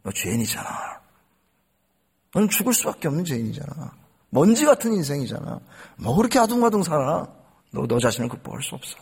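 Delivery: low, soft accusing voice; accusing Satan. Retold or imitated. Preacher voicing Satan accusing the sinner, low and soft. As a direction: drop low and quiet to accuse. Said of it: "결국 우리를 참소하죠".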